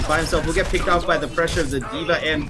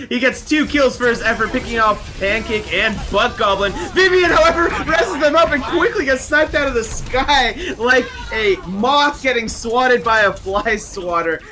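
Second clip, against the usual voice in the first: a bit higher, lively fight call; play-by-play; 6 semitones higher than usual. Acted. Co-caster calling the wipe for viewers, lively. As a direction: narrate the wipe with light energy.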